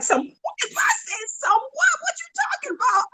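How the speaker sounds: very high, squealed incredulous questions; astonished disbelief. Retold or imitated. Wife hears husband suddenly ask for ham hocks, astonished disbelief. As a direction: reenact stunned disbelief, pitch shooting up. Retelling shock at husband's odd request.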